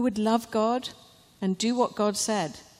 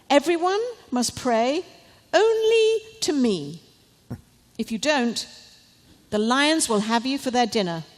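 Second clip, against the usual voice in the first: a lofty, louder king's proclamation, pitched higher; royal decree; 4 semitones higher, 5 dB louder than usual. Acted. King proclaiming his law to his people, in a lofty, higher voice. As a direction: announce the law from the throne.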